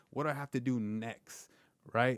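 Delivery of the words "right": ordinary talk, nothing marked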